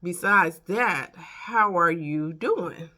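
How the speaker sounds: slow, weighty retold question; friend checking in. Friend asking after her sleep troubles, slow and pointed. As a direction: draw out each word with emphasis. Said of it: "and she said".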